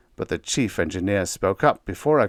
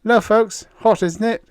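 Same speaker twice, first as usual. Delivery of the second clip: higher, louder breezy hello; amiable greeting; 13 semitones higher, 6 dB louder than usual. A narrator's reading. Biggs greeting the captain and Diane, amiable. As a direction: greet them easily, unbothered. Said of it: "said amiably".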